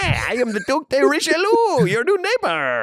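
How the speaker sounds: high, put-on voice of a French nobleman; high Duke voice. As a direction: greet the neighbour in a high, pompous voice. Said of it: "He's like".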